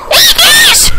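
very high, loud squeak; squeaky voice changer. Retold or imitated. Showing friends his future voice changer, squeaky high shout. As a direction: shout it like a chipmunk voice filter. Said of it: "my voice is gonna be like, like this".

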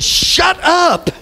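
high, ringing preacher's cry; call-and-response preaching. Real, not acted. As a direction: call it out for the congregation to echo. Preacher leads congregation in response.

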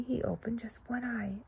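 soft whisper; breathy storyteller whisper; hushed narration. A narrator's reading. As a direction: whisper it like a secret at bedtime. Sleepy bunny opens one eye.

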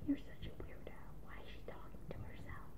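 hushed, whispered imitation of neighbours; gossiping neighbours. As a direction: whisper it like neighbours judging her. Voices neighbours gossiping about her.

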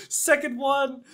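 laughing. Real, not acted. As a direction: giggle through it. Laughing after win.

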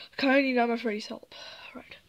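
rushed, hushed whisper; sneaky plotting whisper; fast whisper. Pretend. Sneaking at three A M.

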